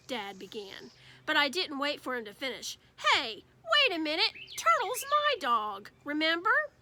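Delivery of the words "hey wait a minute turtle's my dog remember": put-on higher, louder indignant kid; hey, that's mine; mock offended; indignant protest. Peter protesting about his dog.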